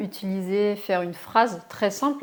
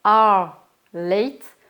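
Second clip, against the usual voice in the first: "you are late" stretched out very slowly and louder, every word separate; slow model reading; 6 dB louder, much slower than usual. Shown as a demonstration. Teacher showing French learners the full form, slow and loud. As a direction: spell it out slowly and loudly for the class. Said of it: "au lieu de dire".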